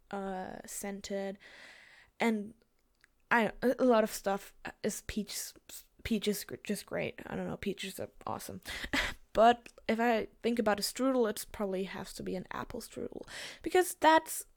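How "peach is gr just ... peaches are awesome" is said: ordinary talk, nothing marked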